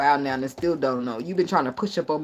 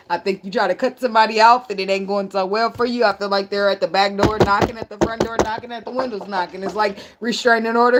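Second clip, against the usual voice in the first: ordinary talk, nothing marked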